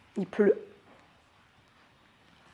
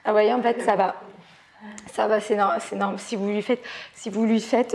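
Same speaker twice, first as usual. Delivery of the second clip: normal talking voice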